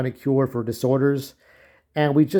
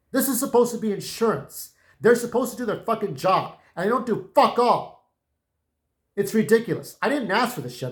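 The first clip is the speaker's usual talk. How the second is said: real exasperation; 7 semitones higher than usual. Real, not acted. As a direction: pitch climbing high in outrage. Furious rant at insurance.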